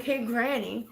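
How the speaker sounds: ordinary talk, nothing marked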